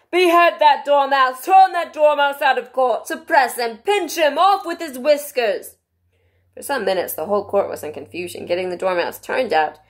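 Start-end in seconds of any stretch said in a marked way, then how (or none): 0.1-5.8 high, loud string of drawn-out orders; Queen shrieking. A narrator's reading. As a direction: shriek each command imperiously. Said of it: "the Queen shrieked out".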